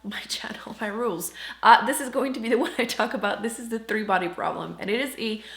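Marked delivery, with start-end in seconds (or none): 0.0-1.3 whispered aside. Real, not acted. Playful aside about her rules.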